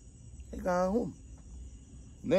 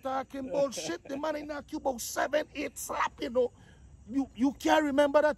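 incredulous; 7 semitones higher than usual. Retold or imitated. Incredulous retort to friend.

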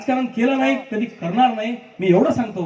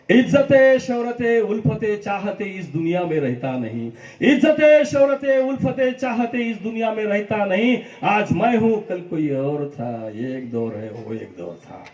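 Rajesh Khanna dialogue. Retold or imitated. Dedicating a Rajesh Khanna dialogue to Ravangavkar. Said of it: "एक Rajesh खन्नाजींचा dialogue मारतो".

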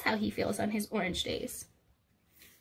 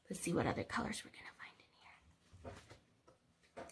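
whisper; 6 semitones lower, 15 dB quieter than usual. Real, not acted. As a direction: whisper it like a secret. Whispered aside while reading.

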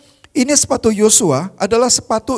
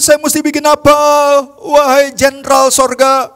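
pleading Joshua; 5 semitones higher, 4 dB louder than usual. Retold or imitated. Joshua face down asking the heavenly commander, loud plea. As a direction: beg for orders face down. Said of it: "dia bilang begini".

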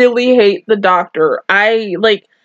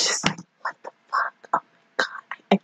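breathy hushed disbelief, barely voiced; stunned whisper; 12 dB quieter, faster than usual. Real, not acted. Reacting to the anime twist, a shocked whisper.

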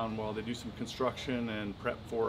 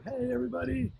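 much higher, eager little turtle voicing; 12 semitones higher than usual. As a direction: squeak it out like a happy turtle.

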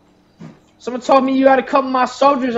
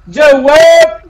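higher, louder drawn-out gang call; loud whooping holler; yelling; 4 semitones higher, 7 dB louder than usual. Pretend. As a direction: yell it out long to the street. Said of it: "Stop yelling at me".

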